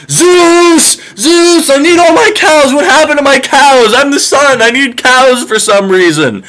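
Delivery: high shrill-toned petulant demands; whiny sun god. Retold or imitated. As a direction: complain like a spoiled god missing his cows. Sun god complaining to Zeus.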